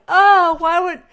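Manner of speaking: high, loud whiny complaint; mock a boyfriend's wounded outburst; mock exasperated boyfriend. Mocking boyfriend's complaint in film.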